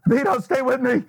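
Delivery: high, loud mock-dramatic plea; man down shout. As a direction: beg a dying buddy to hold on. Mock emergency over dead gadget.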